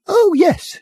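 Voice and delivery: very high, bright piping; piped cheerily. Acted. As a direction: squeak it like a wizened old lady. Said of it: "she piped cheerily".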